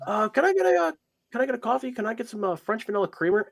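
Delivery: high, hesitant little voice asking for coffee; timid customer. Acted. Player's character asks the barkeep for creamer, high and timid. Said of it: "you kind of come up and just go".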